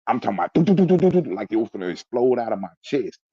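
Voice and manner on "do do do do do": rapid high, loud thumping syllables; machine-gun heartbeat, sung out high; racing heartbeat imitation. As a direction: imitate a pounding, panicked heart. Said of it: "My heart was racing".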